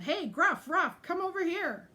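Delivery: high, loud call to his brothers. A narrator's reading. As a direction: shout them over. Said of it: "then called".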